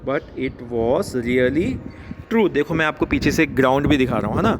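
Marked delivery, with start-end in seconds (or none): 0.1-2.5 very slow, word-by-word poem reading; slow read-aloud. Shown as a demonstration. Teacher reading a poem line to schoolchildren, slow and measured. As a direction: draw out each word for pupils to follow.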